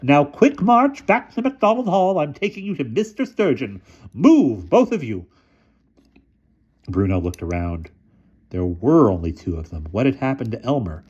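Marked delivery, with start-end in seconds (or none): 0.0-5.2 shrill headmistress. A narrator's reading. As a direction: play the fussy headmistress, pitched way up. Miss Scrimmage orders.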